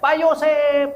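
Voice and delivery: high, drawn-out call; retold shout. Calling Pak Yosep from behind his house, high retold shout. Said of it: "Saya teriak".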